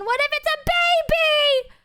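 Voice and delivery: high-pitched panicked yelp; squealed worst-case worry; mock panic. Imagining the cart hitting a baby.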